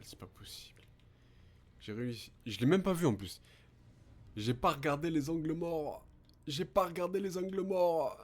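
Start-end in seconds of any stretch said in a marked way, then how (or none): 0.0-0.8 under-breath whisper; whispered frustration. Real, not acted. Frustrated at missing a shot. 2.5-3.4 loud, higher angry self-scolding; frustrated outburst. Real, not acted. Angry at own blind spot. 4.4-6.1 frustrated. Real, not acted. Repeated in frustration. 6.5-8.2 higher, louder angry repeat; louder self-scolding; frustrated louder. Real, not acted.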